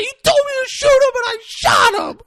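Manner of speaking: very high, loud wailing complaint; mock crying whine. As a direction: whine it like a bawling overgrown kid. Character whines, near crying.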